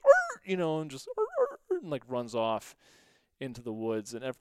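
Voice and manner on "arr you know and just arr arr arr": high yelping dog cries. Retold or imitated. Player imitating the struck dog with high yelps. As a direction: yelp like a hit dog, high and sharp. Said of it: "that dog goes".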